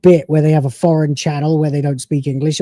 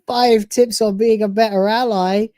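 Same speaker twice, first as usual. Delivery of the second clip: mocking; 7 semitones higher than usual. Mocking the video title.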